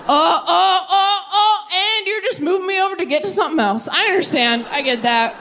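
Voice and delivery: high, hurt but understanding; deflated toy. Retold or imitated. Stuffed animal dropped by the claw, high and hurt. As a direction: swallow the disappointment politely.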